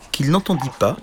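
usual speaking voice

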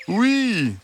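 high, slow, coaxing fox voice; gentle, drawn-out high fox; soft reassuring fox. A narrator's reading. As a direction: draw it out sweetly to win their trust. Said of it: "Une voix douce et rassurante".

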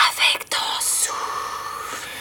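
slow airy whisper, all breath; breathy whisper. Retold or imitated. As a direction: imitate a windy breathy voice. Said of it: "tu parles comme ça".

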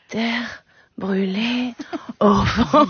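very slow, drawn-out mock seduction; sultry murmur. Lover whispers [name] to a New Yorker, sultry. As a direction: croon it slowly, like hammed-up pillow talk. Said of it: "lui susurrer au lit".

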